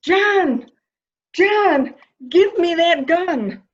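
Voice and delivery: high, loud screaming of his name; frantic scream. Acted. To John holding a gun, a high frantic scream. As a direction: scream at him to drop the gun.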